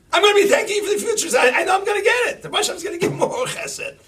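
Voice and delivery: shouted high and fast, full preaching force; fervent shout. Acted. Preacher promising the crowd a blessed future, fervent fast shout. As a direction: shout it out with total conviction.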